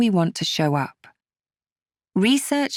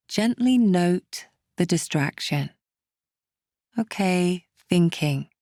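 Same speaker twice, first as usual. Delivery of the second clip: calm, unhurried meditation guide, with long pauses; guided meditation. Acted. Meditation teacher settling listeners to calm political worries, calm and measured. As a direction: ease the listener gently through each step.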